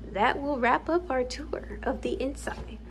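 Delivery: sudden hushed whisper as an aside. Real, not acted. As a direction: drop to a breathy whisper mid-tour. Sudden aside ending the tour.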